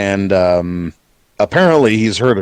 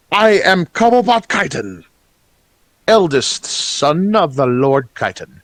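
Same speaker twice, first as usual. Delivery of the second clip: goblin noble voice; 5 semitones higher than usual. Acted. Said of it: "And he says".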